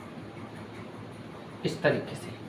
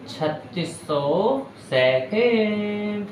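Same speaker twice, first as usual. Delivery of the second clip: छत्तीस सौ second stretched out, higher and very slow; slow repeat; 4 semitones higher, much slower than usual. Shown as a demonstration. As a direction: drag out छत्तीस सौ second so it sticks. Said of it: "teacher repeats it slowly".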